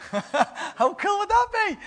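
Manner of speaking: high laughing gush of delight. Real, not acted. Preacher imagines an angel visit, laughing. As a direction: giggle it out, thrilled by the idea.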